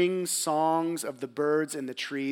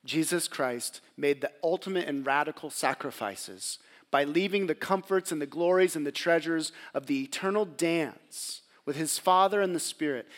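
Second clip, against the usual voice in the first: ordinary talk, nothing marked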